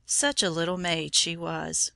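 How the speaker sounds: plain reading voice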